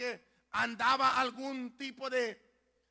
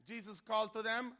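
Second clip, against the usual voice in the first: normal talking voice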